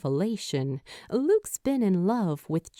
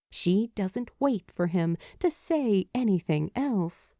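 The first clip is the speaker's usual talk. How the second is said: ordinary delivery, nothing marked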